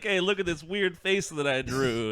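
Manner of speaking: put-on Brooklyn accent. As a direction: play the painter with a hammed-up Brooklyn accent. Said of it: "he's from Brooklyn for some reason".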